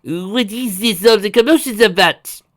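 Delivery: put-on high, loud, drawn-out French accent; flustered Frenchman with a heavy accent. A narrator's reading. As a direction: fuss loudly in a thick French accent. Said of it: "he asked in his heavy French accent".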